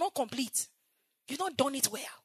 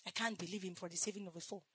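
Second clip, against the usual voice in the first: much softer, faster echo of the line; hushed repeat; 6 semitones lower, 12 dB quieter, faster than usual. Acted. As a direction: drop it to a quick near-whisper. Repeated line, dropped low.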